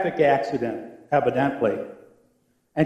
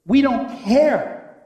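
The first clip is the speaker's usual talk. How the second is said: slow, loud "We don't care" with We hammered; preacher accuses the congregation, slow and emphatic; emphatic declaration; 6 semitones higher, 3 dB louder, much slower than usual. Acted. Stretch it out and lean hard on We. Sermon emphasis then echo.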